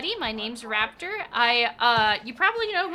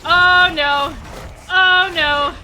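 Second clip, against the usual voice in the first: very high, loud panicked cries; 5 semitones higher, 10 dB louder than usual. Real, not acted. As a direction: panic as the monster wakes. Angered enemy by mistake.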